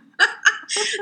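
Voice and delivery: short high-pitched giggle. Real, not acted. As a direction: giggle at your own slip. Laughing at own stumble.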